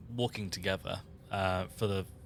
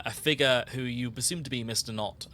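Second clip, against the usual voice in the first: ordinary delivery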